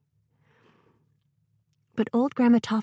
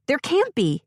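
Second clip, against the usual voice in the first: insistent protest; 8 semitones higher, 3 dB louder than usual. Acted. Child protesting scary story.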